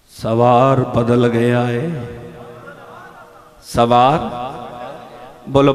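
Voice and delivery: loud, slow proclamations; full-force preaching. Acted. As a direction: hammer the words home to the crowd. Preached and chanted climax.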